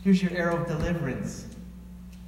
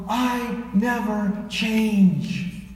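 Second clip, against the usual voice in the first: I never change stretched very slowly; measured, deliberate declaration; slow drawn-out; 4 semitones higher, much slower than usual. Shown as a demonstration. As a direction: lean into each word of I never change. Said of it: "repeated take".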